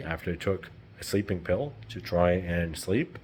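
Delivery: ordinary talk, nothing marked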